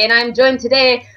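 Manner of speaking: usual speaking voice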